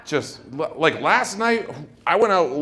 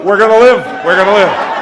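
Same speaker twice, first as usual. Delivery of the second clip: much louder, higher hammed-up cry of relief; overjoyed shout; 7 semitones higher, 13 dB louder than usual. Husband in emergency delivery sees the doctor, overjoyed shout. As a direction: bellow it like a saved man. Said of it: "I'd go".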